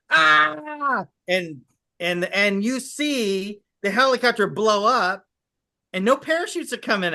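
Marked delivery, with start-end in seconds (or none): 0.1-1.1 short high scream as the chopper blows up; dying pilot screams, high and short; pilot's death scream. Retold or imitated. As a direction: cut off in one sharp high cry. Pilot's helicopter blown up.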